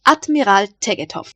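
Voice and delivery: ordinary delivery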